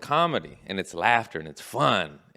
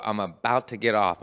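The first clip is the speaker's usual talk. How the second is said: ordinary delivery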